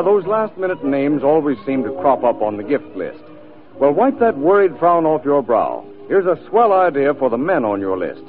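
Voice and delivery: usual speaking voice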